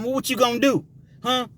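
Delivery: taunting challenge. Retold or imitated. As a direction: dare him to swing. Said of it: "that was a saying back then".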